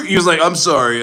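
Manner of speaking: high, loud laughing retelling; laughing while talking. Real, not acted. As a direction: start the quote through laughter. Laughing while mimicking the commenter.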